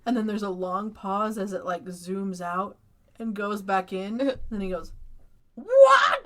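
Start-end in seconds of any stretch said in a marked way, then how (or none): 5.5-6.2 hammed-up delayed shriek of disbelief; shocked shout. Man learning he ate someone, hammed-up shocked shout. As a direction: blurt it out high and very loud, half laughing. Repeated reaction after long pause.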